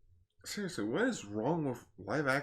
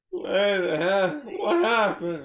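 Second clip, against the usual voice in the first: slow, higher, louder stunned outburst; retold disbelief; 12 semitones higher, 8 dB louder, slower than usual. Retelling his reaction while watching, higher loud disbelief. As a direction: demand it, thrown by the scene. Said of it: "and I'm like".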